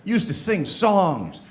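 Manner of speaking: acted angry partner. Partner complains about lost romance, acted angry. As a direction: imitate an upset partner pleading and scolding. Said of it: "she got angry at me".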